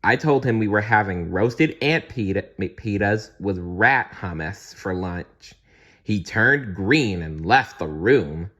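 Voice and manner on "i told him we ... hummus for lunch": usual speaking voice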